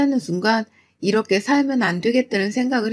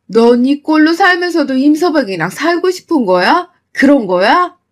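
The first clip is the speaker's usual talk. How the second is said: louder, exasperated mother scolding; mother berating her daughter for hiding the abuse, louder and angry; 4 dB louder than usual. Acted. Mother furious at daughter's silence.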